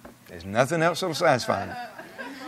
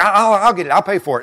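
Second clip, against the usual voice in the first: eager offer; 6 semitones higher, 6 dB louder than usual. Retold or imitated. Acting out eager offer to pay.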